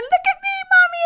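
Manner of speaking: put-on very high, excited child's voice; a little kid bursting in, thrilled with himself; muddy little child. Proud muddy child shouting to his mother, very high and excited.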